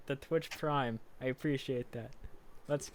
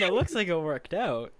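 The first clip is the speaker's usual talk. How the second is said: laughing; 5 semitones higher, 7 dB louder than usual. Real, not acted. Laughing while answering a friend.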